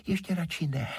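high little fairy voice; high-pitched sprite, reluctant; water fairy. A narrator's reading. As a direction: decline in a small high voice.

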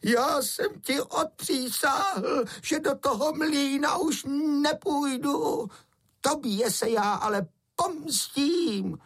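very high, drawn-out croaky goblin threat; goblin calling out. A narrator's reading. Escaped water goblin swearing revenge on the helper, high slow croaking call. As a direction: call back slowly in a high goblin screech. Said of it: "Tam se obrátil a volal".

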